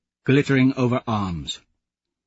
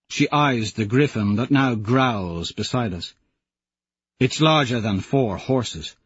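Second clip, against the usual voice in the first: ordinary delivery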